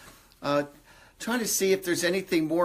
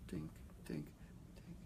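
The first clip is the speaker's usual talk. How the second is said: very soft, whispered dinks; barely audible imitation of a drip; tiny spaced-out whispered dinks; imitated dink sound; 23 dB quieter, faster than usual. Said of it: "I keep on hearing dink".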